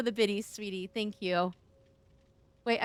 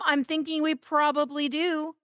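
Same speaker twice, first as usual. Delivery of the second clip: ordinary talk, nothing marked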